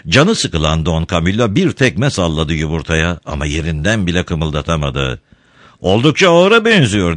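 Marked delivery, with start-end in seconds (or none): 5.8-7.2 higher, louder crowd heckle; bağırma. A narrator's reading. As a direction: call it out across a square, pitched high. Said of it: "diye bağırdı birisi". (yüksek sesle bağırarak)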